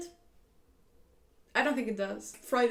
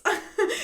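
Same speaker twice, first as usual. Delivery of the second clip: short, high burst of laughter; 11 semitones higher, 5 dB louder than usual. Real, not acted. Laughing at imagined straight face.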